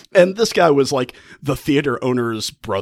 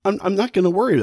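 defiant Lavinia; 9 semitones higher, 3 dB louder, faster than usual. Retold or imitated. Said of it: "but she says".

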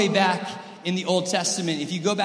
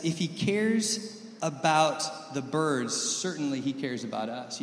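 slow, soft scripture read; 5 dB quieter, slower than usual. Acted. Preacher reading the verse to the congregation, slow and soft. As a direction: lean on cares, read it slowly and softly. Reads the verse slowly, softly.

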